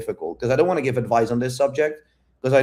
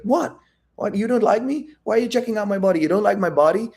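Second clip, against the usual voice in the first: high-pitched mock worried questioning; imitating insecurity; 10 semitones higher than usual. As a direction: play her as self-conscious and challenging. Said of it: "she's getting a little bit insecure".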